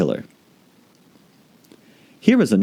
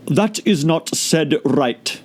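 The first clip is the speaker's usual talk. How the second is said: higher, very slow creature voice; slow caterpillar voice; 7 semitones higher, much slower than usual. A narrator's reading. Caterpillar correcting Alice, very slow. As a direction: judge it coolly.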